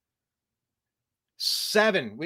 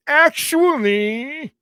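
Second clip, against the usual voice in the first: higher, slow, stretched "Actually"; pedantic know-it-all voice; 7 semitones higher, 5 dB louder, slower than usual. Retold or imitated. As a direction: drag out each "Actually" like a snob. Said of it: "that friend of mine is kinda going".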